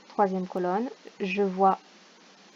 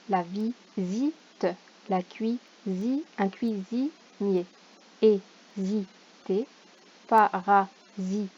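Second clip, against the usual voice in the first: each word read slowly, syllable by syllable; much slower than usual. Shown as a demonstration. Teacher correcting exercise for parents, slow syllable reading. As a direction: lay out every syllable clearly. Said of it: "reads word list syllable by syllable".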